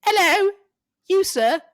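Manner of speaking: high, loud, slow British toff voice; hammy British accent. Pretend. Taking listeners on a journey to London, in a hammed-up British accent. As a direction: play the posh Londoner to the hilt. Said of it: "take them on a journey to".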